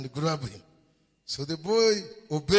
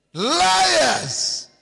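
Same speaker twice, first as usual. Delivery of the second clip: high, loud condemning shout; shouted accusation; 12 semitones higher, 10 dB louder than usual. Real, not acted. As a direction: hurl the word at them. Preacher denounces lying women.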